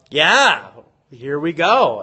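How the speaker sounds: normal talking voice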